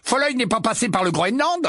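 high, light friendly greeting; cheerful young man's hello; Bill's voice. Acted. Bill greeting Harry across the table, friendly.

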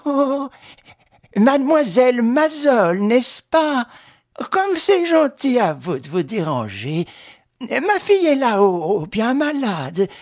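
high, loud gushing welcome; effusive put-on delight; happy and grateful. A narrator's reading. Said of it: "prit une intonation heureuse et reconnaissante".

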